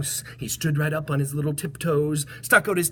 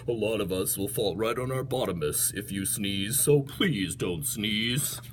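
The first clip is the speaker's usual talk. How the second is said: lower, softer lumbering hippo; lightly voiced low hippo; 6 semitones lower, 4 dB quieter than usual. Hippo speaking.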